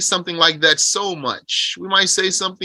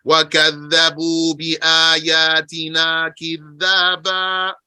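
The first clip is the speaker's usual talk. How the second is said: measured chanted recitation of the verse; Quran recitation. Acted. Teacher reciting the verse to his class. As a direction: recite it like scripture.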